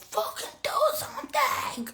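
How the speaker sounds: high, slow, hammed-up scream at the sky; mock scream. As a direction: yell it at the universe. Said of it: "in the car cursing everybody out".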